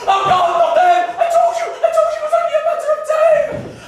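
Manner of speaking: loud alarm climbing high; hammed-up terror; panicked wailing. Panic over "them.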